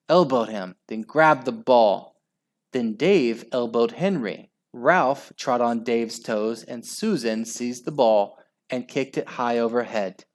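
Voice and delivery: ordinary delivery, nothing marked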